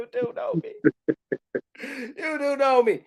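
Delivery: high, tearful laughing-crying; choked up. Real, not acted. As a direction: let the voice break with joyful tears. Overcome with emotion answering God.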